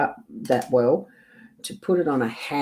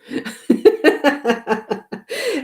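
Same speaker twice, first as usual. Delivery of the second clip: a long rising giggle, much higher; 13 semitones higher, 4 dB louder than usual. Real, not acted. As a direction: laugh it off at yourself. Laughs after admitting indecision.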